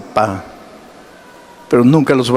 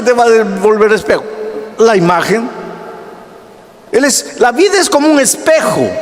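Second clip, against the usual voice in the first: higher, rising sermon voice building to a peak; preaching at full force; 10 semitones higher than usual. Acted. Pastor preaching hard to his church about temper. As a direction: preach it out to the congregation.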